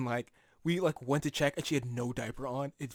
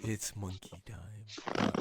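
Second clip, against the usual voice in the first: very slow, low and soft; drawn-out hushed last words; fading sage; 6 semitones lower, 7 dB quieter, much slower than usual. Acted. Repeating the sage's parting line for laughs, slow and hushed.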